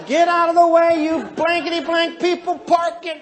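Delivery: high, loud road-rage shout; yelling. Acted. Red driver in the fast lane at others, yelling. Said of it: "yelling at people".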